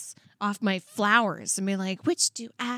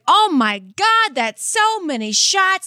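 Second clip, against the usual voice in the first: higher, louder, slowed right down in mock disbelief; shocked bartender; 8 semitones higher, 6 dB louder, slower than usual. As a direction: gasp it out as an overwhelmed bartender. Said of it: "the bartender's like".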